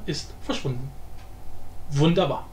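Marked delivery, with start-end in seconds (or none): none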